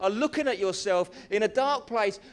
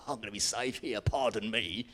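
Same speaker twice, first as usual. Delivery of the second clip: chuckling smugness; 9 semitones lower, 4 dB quieter than usual. Acted. Gideon smug, laughing.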